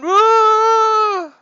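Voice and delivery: high, loud hooting ghost moan; spooky high ghost woo. Pretend. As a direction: howl it like a cartoon ghost. Ghost super close, even louder.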